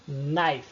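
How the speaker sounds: "Knife" said as "naif" with no k sound; silent k. Shown as a demonstration. Teacher shows the class the silent k, clearly. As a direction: drop the k in "Knife", start on n. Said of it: "Percebam que eu não tô pronunciando".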